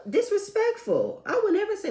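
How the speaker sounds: clutch your pearls at the insult; scandalized. Real, not acted. Reacting to rude quote.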